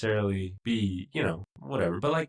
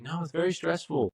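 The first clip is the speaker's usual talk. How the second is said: imitated whiny protest; 9 semitones higher than usual. Imitating Matt defending his job.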